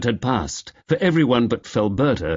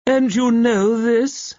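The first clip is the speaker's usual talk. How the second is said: put-on drunken slur; 13 semitones higher, 3 dB louder, slower than usual. Drunk woman still taunting.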